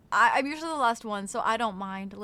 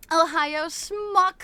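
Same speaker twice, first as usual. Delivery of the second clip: excited greeting; 5 semitones higher, 7 dB louder, much slower than usual. Real, not acted. Greeting a viewer.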